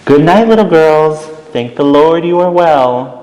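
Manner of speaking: tuck them in with relief; Miss Clavel goodnight. Acted. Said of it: "said Miss Clavel".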